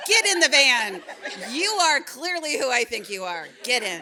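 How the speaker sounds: loud and high, laughing through it; loud amused bark at the van door; laughing command. Retold or imitated. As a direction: laugh as you order her in. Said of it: "And I went".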